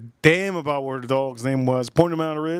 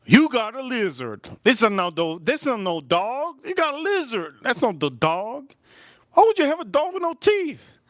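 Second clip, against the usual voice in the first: high, squealing mock incredulity; comic outraged disbelief; 9 semitones higher than usual. Telling girlfriend her toothless dog is a lizard, incredulous. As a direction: protest with the pitch shooting way up. Acting out his outraged reply.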